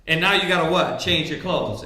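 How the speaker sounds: ordinary delivery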